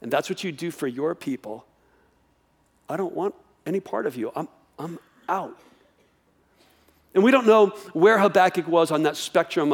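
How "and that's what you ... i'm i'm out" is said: softer, resigned walking away; giving up on God. Retold or imitated. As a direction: quietly turn your back and say you are done. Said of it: "the tendency is just to go".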